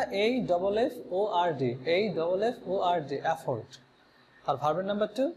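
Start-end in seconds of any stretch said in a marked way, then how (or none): 0.0-3.2 slow spelling. Shown as a demonstration. Teacher slowly spells afford for students. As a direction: spell A double F O R D letter by letter, slowly. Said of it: "তাহলে কি বানানটা?".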